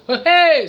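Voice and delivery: high, loud "hey!" outburst; sudden high holler; sudden noisy yelp. Shown as a demonstration. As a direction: blurt it out as a random vocal noise. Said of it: "I do a lot of noises".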